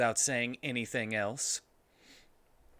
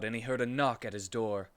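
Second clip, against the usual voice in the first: ordinary talk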